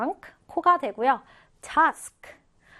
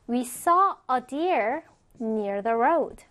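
slow, with a break after "deer"; measured, carefully separated phrases; slow model reading; much slower than usual. Shown as a demonstration. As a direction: read it out slowly for learners. Teacher's first, slower model reading.

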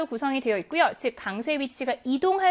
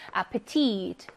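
아페티트 stressed on the long last vowel 티; teacher's demo of 아페티트, final vowel stressed; 5 semitones lower, much slower than usual. Shown as a demonstration. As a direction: lean on 티 at the end of 아페티트. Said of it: "마지막 모음의 강세가 있습니다".

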